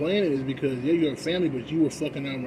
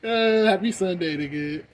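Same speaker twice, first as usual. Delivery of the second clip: mock greeting; 6 semitones higher, 6 dB louder, slower than usual. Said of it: "mock Sunday greeting".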